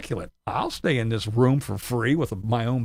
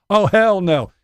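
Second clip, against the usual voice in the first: mock neighbor outrage; 6 semitones higher, 8 dB louder than usual. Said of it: "somebody's gonna drive by in a little golf cart and go".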